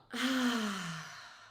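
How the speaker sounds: long open ah of relief; relief sigh. Shown as a demonstration. Coach showing a relieving ah while breathing out. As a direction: let the breath fall out in an ah. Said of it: "actually make that ah sound for me".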